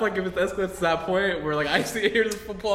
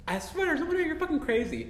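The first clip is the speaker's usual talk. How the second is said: high, softer mock-frantic wife voice; protesting wife; 10 semitones higher, 4 dB quieter than usual. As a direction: imitate a wife protesting her innocence. Acts movie wife denying to husband.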